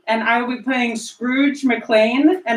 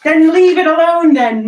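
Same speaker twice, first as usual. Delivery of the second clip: angry retort; 5 semitones higher, 7 dB louder than usual. Acted. Scrooge snaps at nephew.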